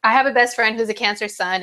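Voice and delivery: usual speaking voice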